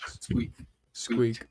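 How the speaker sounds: whispered little rat squeaks; quiet rat squeaking. Acted. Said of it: "You hear a very low squeaking".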